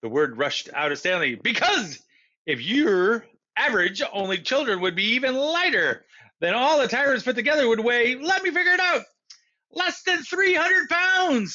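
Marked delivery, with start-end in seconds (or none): 1.3-9.1 put-on higher, louder eager boy; excited rush. Stanley rushes out his idea. 9.7-11.5 higher, louder, slow call; calling out answer. Acted. Mister Lambchop calls out answer.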